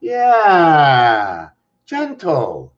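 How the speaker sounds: woo the lamp like a shy pet; playful sweet-talk. Real, not acted.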